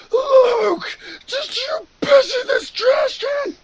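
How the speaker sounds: very high, loud mock shout; Hector's outraged yell. Boss confronting Luke over the trash can, outraged yell. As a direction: yell the accusation, stretched out and high.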